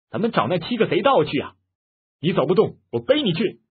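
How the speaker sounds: rally him to set off at once; urgent rallying. A narrator's reading. Mei urges the lame man toward revenge, forceful rallying.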